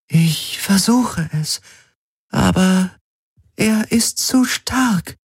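slow, high, halting computer voice; struggling AI. A narrator's reading. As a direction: speak haltingly, as if overpowered. Helix struggles against the telepathic attack. (angestrengte, stockende KI-Stimme)

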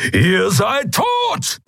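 high, drawn-out roar; thundering threat. A narrator's reading. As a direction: roar a death threat at the captors. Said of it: "donnerte Keru". (donnernd gebrüllte Drohung)

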